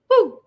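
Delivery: high, loud woo; cheer. Real, not acted. Podcast host whooping as a cheer. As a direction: whoop in celebration.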